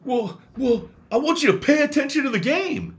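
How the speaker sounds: high, loud whiny old-man mimicry; squeaky mock old man; mocking the grandpa. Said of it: "He goes".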